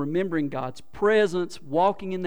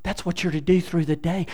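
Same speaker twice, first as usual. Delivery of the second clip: normal talking voice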